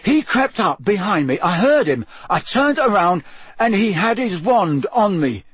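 agitated, high, breathless account; loud, high-pitched shock after an attack; panting, shaken. A narrator's reading. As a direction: blurt it out shaken, voice climbing. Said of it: "panted Cedric".

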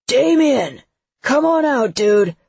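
high, loud call; shouting. A narrator's reading. Seth pounds on Damien's door, shouting. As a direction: holler through a closed door.

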